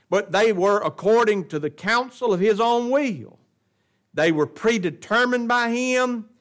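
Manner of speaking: loud, high-pitched pulpit proclamation; forceful preaching. Real, not acted. Pastor proclaiming Christ's suffering to his congregation, forceful preaching. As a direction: preach it out with weighty, punched words.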